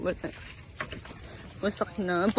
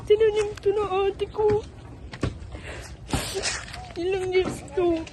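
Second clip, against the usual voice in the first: tearful, fighting back tears; crying; 6 semitones higher than usual. Real, not acted. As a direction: voice breaking, higher. Crying over destroyed garden.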